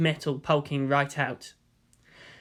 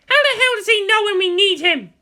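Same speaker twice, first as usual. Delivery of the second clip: higher, louder angry complaint; exasperated shout; 7 dB louder than usual. Acted. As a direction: yell in frustration. Asuka exasperated.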